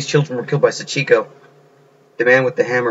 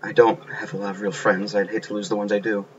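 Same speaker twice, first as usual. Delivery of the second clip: mumbling; 2 semitones lower, 7 dB quieter than usual. Acted. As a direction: mumble it under your breath, barely meant to be heard. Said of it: "What are you mumbling about?".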